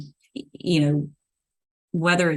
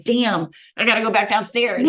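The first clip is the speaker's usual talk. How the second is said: higher-pitched, playful grumble; mock exasperation; 6 semitones higher, 3 dB louder than usual. Client joking about her stairs, mock exasperation. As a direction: play up a trivial complaint. Quoting own casual present-day reaction.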